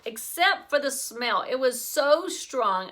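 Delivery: usual speaking voice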